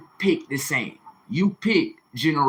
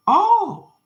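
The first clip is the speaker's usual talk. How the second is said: sharp high mocking "Oh"; a squeal of fake discovery, high and loud; 5 dB louder than usual. Real, not acted. Mocking realization before lecturing woman.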